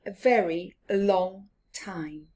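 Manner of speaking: plain reading voice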